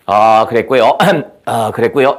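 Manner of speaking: a hint of an old teacher's throat-clearing drone; lightly mock the teacher's "어, 그랬고요"; imitating Teacher Gom. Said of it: "이런 소리를 내요". (곰 선생님 말투를 가볍게 흉내 내듯)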